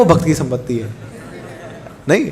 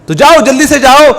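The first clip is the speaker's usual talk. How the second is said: urgent command; 7 semitones higher, 7 dB louder than usual. Acted. Deity urging priest to hurry.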